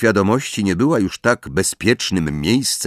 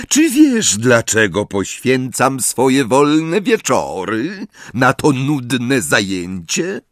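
slow, raised-pitch menace; menacing; 6 semitones higher, 3 dB louder, slower than usual. A narrator's reading. Snape demands an answer from Harry, slow and menacing. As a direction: draw the question out threateningly. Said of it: "groźnym głosem".

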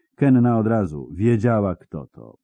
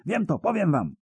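desperate begging; 12 semitones higher than usual. Acted. Spy keeps begging.

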